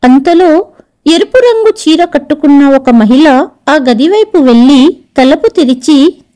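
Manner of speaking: ordinary delivery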